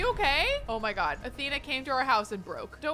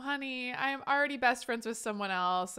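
ordinary delivery

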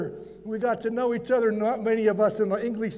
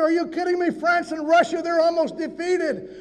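high, loud, incredulous retort; acted incredulous outburst; loud disbelief; 7 semitones higher, 6 dB louder than usual. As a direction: scoff as if the news is absurd. Germans scoff at English newspapers.

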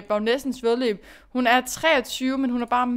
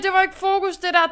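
annoyed self-scolding; 6 semitones higher than usual. Real, not acted. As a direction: tell yourself off for losing focus. Scolding herself after mistake.

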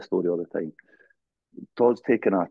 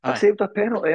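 much higher and louder, proud and excited; proud repeat; 12 semitones higher, 5 dB louder than usual. Real, not acted. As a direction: repeat it with a burst of pride. Proudly repeating his penalty save.

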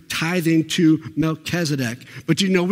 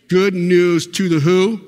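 louder, slower prompt on who; call for response; 5 dB louder, slower than usual. Real, not acted. Preacher prompts the congregation to finish the verse, louder. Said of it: "what's that?".

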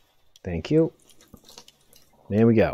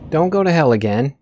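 alarmed plea; 5 semitones higher, 6 dB louder than usual. Real, not acted. Warning dog in game.

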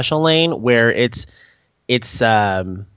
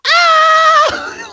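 very high, louder shriek of disgust; high mock scream of disgust; 6 dB louder than usual. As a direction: yelp at the sight of the vomit. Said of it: "And I'm just like".

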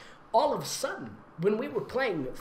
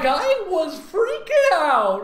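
mock dramatic; 11 semitones higher, 7 dB louder, much slower than usual. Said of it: "And you're just like".

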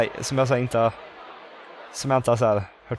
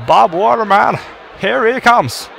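high, loud English introduction; announcer's hype call; 11 semitones higher, 10 dB louder, slower than usual. Acted. Player announcing the celebrity's arrival, high excited hype call. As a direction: belt out the star's entrance like an announcer.

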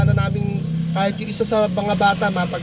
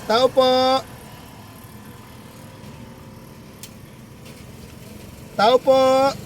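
calling from far away; 5 semitones higher, 4 dB quieter, much slower than usual. Real, not acted. Calling at a house gate.

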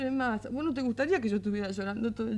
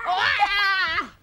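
high, loud mock war cry; play battle yell; 9 semitones higher, 7 dB louder than usual. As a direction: yell as you pounce in a play fight.

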